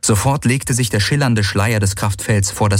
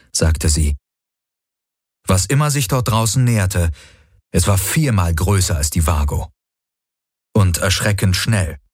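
plain reading voice

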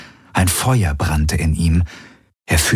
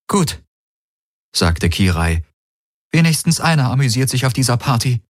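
bitter sarcasm; 6 semitones higher than usual. A narrator's reading. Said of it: "Ihre Stimme klang tonlos".

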